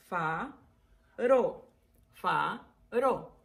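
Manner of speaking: "Faro" split into fa, ro; syllable by syllable. Shown as a demonstration. Teacher showing two syllables, "Faro" split fa... ro. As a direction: say "Faro" with a gap between fa and ro. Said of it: "faro, dos sílabas".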